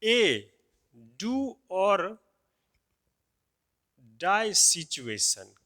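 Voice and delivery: very slow, words drawn apart; dictating the phrase slowly; slow dictation. Shown as a demonstration. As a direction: spell it out slowly for the class. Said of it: "अब जैसे देखिए जरा सा".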